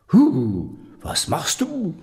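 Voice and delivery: loud, high angry yelp; angry devil shout. Acted. Devil angrily shouts at the grandmother for pulling his hair. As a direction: snap at her in pain and anger. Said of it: "schrie der Teufel zornig".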